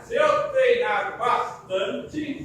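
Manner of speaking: slow and loud around a pen; pen in mouth. Shown as a demonstration. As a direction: pronounce slowly, pen between teeth. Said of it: "Com a caneta na boca".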